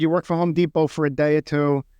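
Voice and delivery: ordinary talk, nothing marked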